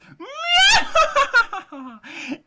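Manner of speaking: high shrieking witch cackle; spiteful witch laugh. A narrator's reading. Teacher reading the witch gloating over the children, shrieking cackle. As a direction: cackle with glee like a wicked witch. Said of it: "she gave a spiteful laugh".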